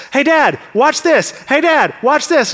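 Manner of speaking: put-on eager kid begging Dad to watch; kid calling out. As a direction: call for Dad like an excited kid. Kids calling out while swimming.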